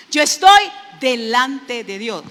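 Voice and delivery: slow, loud shouted declaration; preaching shout. Acted. Preacher shouting to the congregation, slow and loud. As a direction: proclaim it to the back of the church.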